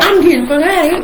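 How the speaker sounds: high, loud, fast panicked declaration; breathless urgent exit line; frantic mom. Retold or imitated. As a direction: rush it like a scared mother escaping. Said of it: "she was like".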